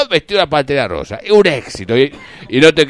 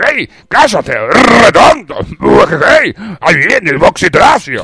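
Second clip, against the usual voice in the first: higher, louder cartoon villain voice; Profesor Neurus voice; 5 semitones higher, 7 dB louder, slower than usual. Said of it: "y yo decía".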